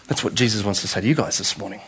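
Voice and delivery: ordinary talk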